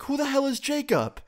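high, exasperated disbelief; teen baffled by the man asking for Jacob, high-pitched; baffled outburst. Retold or imitated. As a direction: throw the question back, baffled. Teen baffled by stranger's question.

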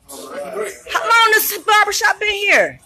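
high and slow, laughing through it; laughing question. Real, not acted. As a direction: ask it while laughing, delighted. Host chatting with barbers.

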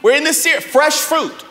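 loud, high preaching, pushing each phrase out; preaching at full force. Acted. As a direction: preach it to the back row.

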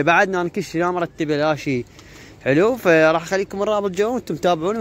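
ordinary delivery

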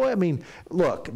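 ordinary talk

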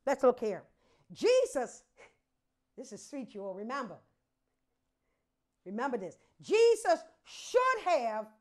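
usual speaking voice

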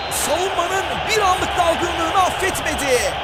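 live play-by-play. Real, not acted. Live match commentary.

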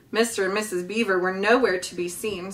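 usual speaking voice

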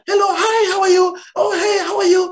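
high-pitched, gushing greeting to strangers; pleasant, positive tone. Acted. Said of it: "I mean, positive tone".

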